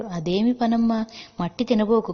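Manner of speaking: grandfather's startled reproach, said out loud; alarmed scolding. A narrator's reading. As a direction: stop her at once, scolding but fond. Grandfather stops her eating mud.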